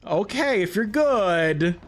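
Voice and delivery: drawn-out, reluctant dad reply; long stretched hesitation, imitating a parent; hesitant dad. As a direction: mull it over slowly as a dad giving in. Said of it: "he's like".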